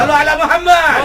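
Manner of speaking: high, loud call to respond; shouted call. Real, not acted. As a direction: rouse the congregation to answer. Preacher calls congregation to respond.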